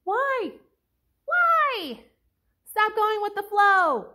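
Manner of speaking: high-pitched, hammed-up exasperation; exasperated pleading. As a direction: plead with a foolish heroine to stop. Exasperated pleading with fictional heroines.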